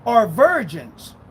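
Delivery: slow, emphatic scripture reading. Real, not acted. Preacher reading Revelation to doubters, slow and emphatic. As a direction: read it slow, driving each phrase home. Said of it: "I'll read it slow".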